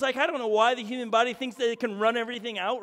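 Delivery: ordinary talk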